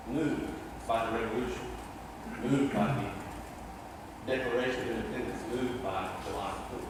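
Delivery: low, soft and reverent, each line fading; hushed refrain. Real, not acted. Preacher to congregation on the revolution, hushed.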